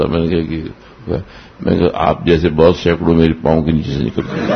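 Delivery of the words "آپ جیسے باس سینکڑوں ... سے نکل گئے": usual speaking voice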